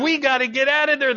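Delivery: a hint of panicked high voice; fleeing panic. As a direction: imitate someone running for their life. People fleeing falling towers.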